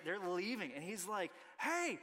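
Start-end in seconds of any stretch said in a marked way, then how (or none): 1.6-2.0 put-on high, loud eager child calling out; excited boy. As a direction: bubble over like a kid inviting his new friend. Son inviting friend, imitated child.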